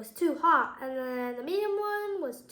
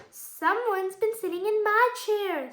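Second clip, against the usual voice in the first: lightly voiced mama bear, higher pitch; a hint of storybook mother bear; medium-sized Mommy Bear; 6 semitones higher, 4 dB louder than usual. A narrator's reading. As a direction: read it as a mid-sized bear, raised in pitch. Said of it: "in a medium-sized voice".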